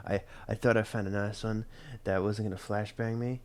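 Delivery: ordinary talk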